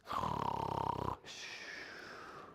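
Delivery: long sleepy breath in and out; pretend snoring. Storyteller acts out Bear dozing, pretend snore. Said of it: "the bear starts to doze".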